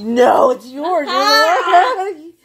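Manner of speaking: loud high laughing shout; laughing protest. Real, not acted. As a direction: yell it while cracking up. Blaming friend, laughing.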